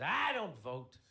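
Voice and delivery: loud, indignant retort, lightly acted; hammed-up defensive outburst; mildly upset brother. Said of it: "My brother's all upset about the election".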